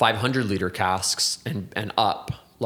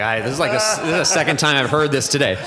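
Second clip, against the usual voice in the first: mock exasperation, higher and louder; eye-rolling complaint; 6 semitones higher, 6 dB louder, faster than usual. Speaker imitates Emma rolling her eyes at his musing, mock-exasperated. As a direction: imitate someone fed up with hearing it. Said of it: "Emma's rolling her eyes".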